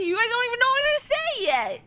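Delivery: high indignant protest. Real, not acted. Defends himself against chat.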